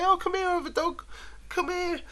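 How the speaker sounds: a gentle dog calling a new friend over; voicing the friendly dog. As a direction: sweet-talk the other dog as Jacko would. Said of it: "wag his tail and say".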